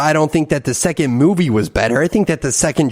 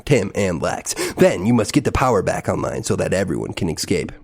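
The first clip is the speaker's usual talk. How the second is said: lower, softer dramatic announcer read; box blurb read; 8 semitones lower, 3 dB quieter than usual. Acted. Host reading the game's blurb, lower trailer voice.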